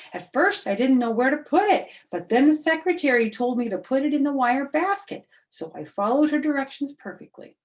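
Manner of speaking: put-on high, loud eager little girl voice; high, bright know-it-all girl; bragging May. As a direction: show off like a know-it-all schoolgirl. May boasts.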